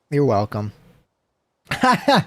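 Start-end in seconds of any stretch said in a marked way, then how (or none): 1.7-2.2 real laugh breaking through. Real, not acted. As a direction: giggle through the word. Laughing after the howl.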